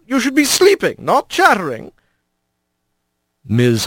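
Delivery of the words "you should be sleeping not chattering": high-voiced old opossum grandmother, mild scold; a lightly voiced grandma calling the kids to sleep. A narrator's reading. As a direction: hush the children gently but firmly. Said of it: "a louder voice spoke up".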